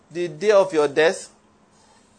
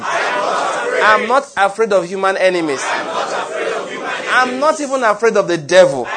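fiery preaching; 4 semitones higher than usual. Acted. Preacher rallying the congregation against fear. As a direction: preach it full force to the crowd.

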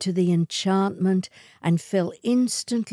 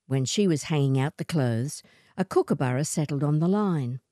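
ordinary delivery

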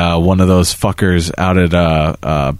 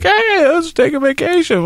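put-on much higher, eager child; a little boy begging his dad; kid voice. Son's voice in story.

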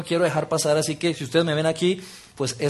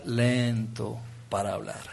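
lento para hablar, slow, much lower and softer; 8 semitones lower, 11 dB quieter, slower than usual. Shown as a demonstration. Preacher leading the hand exercise, slow and soft. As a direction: let each word sink down slowly. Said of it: "la van a empezar a cerrar muy lento".

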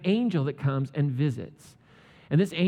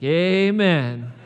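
loud, smug amen; hammed-up relieved wife; cheeky agreement; mock amen; 8 dB louder than usual. Wife glad husband is mute.

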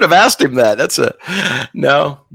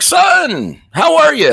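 dad voice; 3 dB louder, much slower than usual. Retold or imitated. Dad voiced in phone story.

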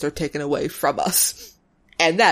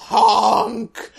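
silly clown honk. Pretend. Goofy honking noises.

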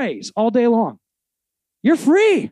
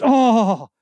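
a louder awed "Oh" drawn out in wonder; 5 semitones higher, 3 dB louder than usual. Shown as a demonstration. Pastor showing how to savor Jesus' name, awed oh. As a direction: sigh out "Oh" in delighted awe. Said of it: "that's how it should be with Jesus".

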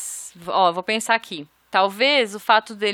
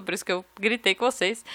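ordinary talk, nothing marked

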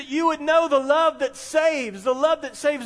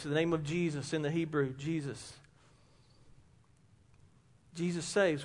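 much softer and lower on Jesus; reverent hush; 9 semitones lower, 11 dB quieter than usual. Real, not acted. Preacher lowering his voice over Jesus' name, reverent hush. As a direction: sink to near hush in reverence.

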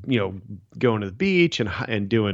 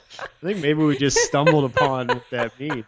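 higher-pitched, talking through laughter; laughing; 4 semitones higher than usual. Real, not acted. Co-host still amused by the tea joke, laughing. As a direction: keep giggling as you say it.

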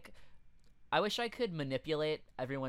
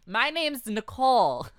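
higher, louder put-on booth model; booth babe; 7 semitones higher, 6 dB louder than usual. Cohost plays a booth babe greeting visitors, booth babe. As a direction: introduce yourself as a perky mock booth babe.

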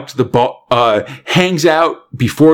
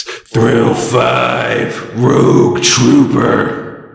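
title announcement; 2 semitones lower, much slower than usual. Acted.

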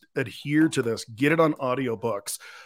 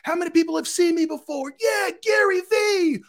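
much higher, loud mock crowd cheer; 6 dB louder than usual. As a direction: whoop like an excited crowd. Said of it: "Everybody's like".

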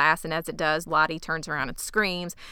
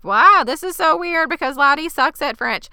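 higher, louder hammed-up astonishment; mock amazement; 9 semitones higher, 9 dB louder than usual. Girls react to Lottie speaking French, mock amazed. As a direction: imitate gaggle of amazed girls.

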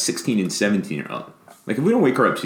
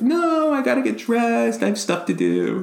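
high-pitched mock kid voice; imitated daughter protesting. Father mimicking overdressed daughter's refusal, high mock protest. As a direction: protest like an earnest kid, hammed up.

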